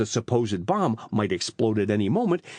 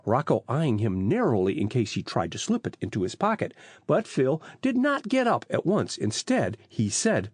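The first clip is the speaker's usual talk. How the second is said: ordinary delivery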